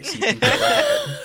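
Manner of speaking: put-on high-pitched old granny voice. Shown as a demonstration. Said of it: "practice my granny voice quick".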